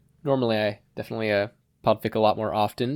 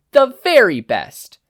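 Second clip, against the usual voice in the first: much higher, louder and slow; cocky boast; 13 semitones higher, 11 dB louder, slower than usual. Acted. Katsuki boasting to Shishido, loud cocky crow. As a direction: crow it out, full of yourself.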